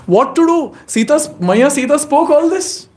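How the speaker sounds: high-pitched, flustered self-defence; acted plaintive protest; helpless protest. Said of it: "Lakshman said".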